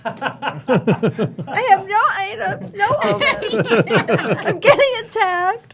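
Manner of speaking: high laughing wail, no items; laughing panic. Real, not acted. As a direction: laugh out a helpless protest. Panicking while attacked.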